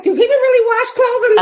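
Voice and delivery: put-on high, squeaky disbelieving girl voice; shocked young girl. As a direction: mimic a naive girl asking in shock. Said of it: "She was like".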